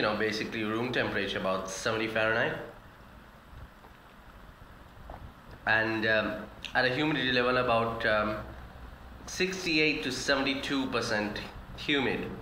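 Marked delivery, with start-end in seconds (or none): 1.7-12.3 speech interrupted by swallowing; talking between sips of soup. Real, not acted. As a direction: spoon at the lips while talking. Seen 0: Spoon of soup at mouth; sips between words, lips pressed at 1.9.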